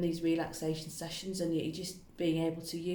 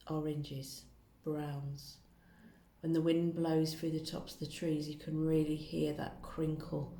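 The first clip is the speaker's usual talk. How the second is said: guided relaxation. Acted. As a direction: gently guide a listener into calm.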